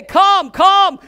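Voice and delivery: booming call of the Lord; loud, commanding divine invitation. Retold or imitated. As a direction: call out big, like God inviting. The Lord's voice quoted.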